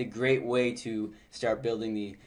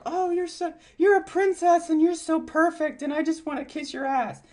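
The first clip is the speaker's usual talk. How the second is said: mock fawning suitor, much higher; high, hammed-up groveling admirer. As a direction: gush like a lovesick pushover, pitched way up. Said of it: "you're too much like".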